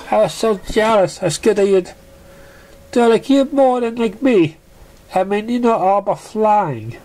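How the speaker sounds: high pony voice, confessing; a filly admitting she was scared; high cartoon pony, sheepish; jealous young pony. Pink confesses.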